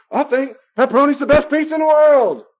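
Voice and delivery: loud, high, hammed-up declaration of opinion; mock proclamation. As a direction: belt it out as an over-the-top opinion. Mimicking someone stating a belief.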